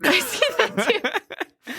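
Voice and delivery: slow, high, through giggles; laughing. Real, not acted. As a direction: speak while laughing, slowly. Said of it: "can't stop laughing now".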